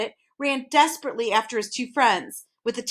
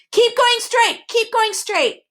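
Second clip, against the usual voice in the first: slow, much higher calling voice; 13 semitones higher, 5 dB louder, slower than usual. A narrator's reading. Hidden voice guiding the boys, calling out. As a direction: call them over from a distance. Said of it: "counseled the voice".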